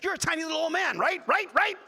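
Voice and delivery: high, loud, badgering voice; imitating a pushy guy, hammering for agreement; shrieky, insistent paraphrase of the insult; mock insistent heckler. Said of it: "seriously, he's like".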